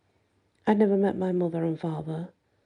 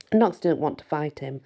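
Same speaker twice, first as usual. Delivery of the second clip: ordinary delivery, nothing marked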